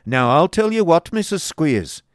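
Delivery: loud, high-pitched stern declaration. A narrator's reading. Schoolmaster tells wife he'll have his own way, stern. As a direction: lay down the law forcefully. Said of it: "said Squeers sternly".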